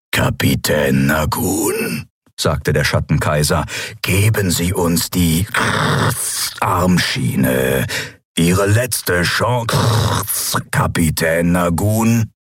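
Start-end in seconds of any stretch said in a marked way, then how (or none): 0.1-2.1 slow, lower, menacing emperor voice; shadow emperor. Acted. Schattenkaiser spricht. 4.0-12.3 shadow emperor. Acted. Schattenkaiser droht.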